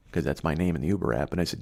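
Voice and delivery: ordinary delivery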